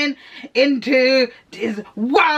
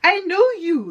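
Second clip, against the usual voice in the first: pleading in a much higher voice; 8 semitones higher than usual. Acted. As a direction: beg him not to push her away, pitched up. Billy pleads with Brad.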